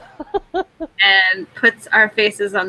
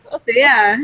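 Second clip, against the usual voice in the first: words squeezed out through laughter; laughing; 6 semitones higher than usual. Real, not acted. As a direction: say it while cracking up. Laughing at own joke.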